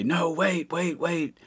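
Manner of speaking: retelling a woman calling out; woman's frantic voice. Retold or imitated. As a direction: quote her in a high, hurried voice. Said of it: "I hear her say".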